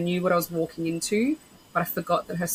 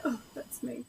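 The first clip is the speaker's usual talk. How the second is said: quietly answering; 4 semitones higher, 12 dB quieter than usual. Retold or imitated. Answering the barista.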